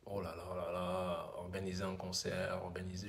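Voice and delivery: whispered grumble; whispering. Real, not acted. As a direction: mutter it under your breath. Muttering to himself.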